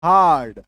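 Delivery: हार्ड said alone, loud, long a; careful teacher's demo; demo word. Shown as a demonstration. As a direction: model हार्ड with an open a. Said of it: "आकार की मात्रा को देखिए".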